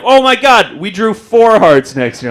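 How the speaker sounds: high, loud gush of amazement; over-the-top thrilled cheering-on; mock excited praise. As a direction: exclaim it loudly, hugely impressed. Enthusiastic praise retold in mock excitement.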